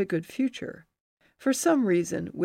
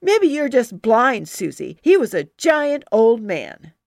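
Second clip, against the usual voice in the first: louder, huffy retort; sassy comeback; 4 semitones higher, 9 dB louder than usual. Acted. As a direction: snap back with sass. Playground quarrel, hands on hips.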